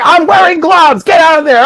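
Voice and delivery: high, sharp order; bossy tester. Retold or imitated. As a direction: shoo her out of the stall.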